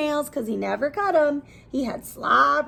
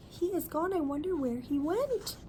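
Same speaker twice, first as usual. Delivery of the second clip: hushed wonder; 2 semitones higher, 9 dB quieter than usual. Acted. People wondering.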